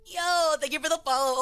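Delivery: ordinary delivery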